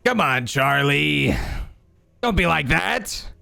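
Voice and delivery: sinister sing-song villain voice; sinister clown. Acted. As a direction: coax mockingly, like a menacing clown. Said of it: "sinister sounding voice".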